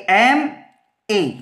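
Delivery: letter by letter, slowly; एमए drawn out as two separate letters; spelled out. Shown as a demonstration. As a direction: say M, then A, with a gap between in एमए. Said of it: "अब इसको अपन लिख के देखेंगे".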